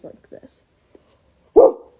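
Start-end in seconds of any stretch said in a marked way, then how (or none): 1.5-1.9 loud high wolf howl. Shown as a demonstration. Demonstrating a howl for the tutorial, very loud. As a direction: let a full howl rip, high.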